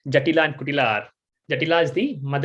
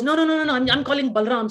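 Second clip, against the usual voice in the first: much higher, rapid no-no-no; insistent mother; 9 semitones higher than usual. Acted. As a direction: wave the boy off and call others. Mother refusing Krishna, calling others.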